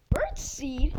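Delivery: puzzled whisper; whispered question. Real, not acted. Boy finding bird seed in hiding, whispered. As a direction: hush it, curious.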